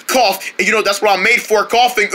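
usual speaking voice

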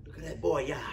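a hint of an elderly onlooker; old man admiring a strong boy, lightly voiced; old person talking. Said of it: "Older person would tell him".

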